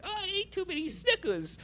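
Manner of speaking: put-on very high, childish whine; mock little-kid voice. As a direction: play a kid with a tummy ache. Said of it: "a youthful kid, kid thing".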